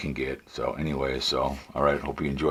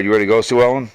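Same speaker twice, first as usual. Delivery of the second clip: calling out; 6 semitones higher, 7 dB louder, faster than usual. Real, not acted. Calling his wife to ride.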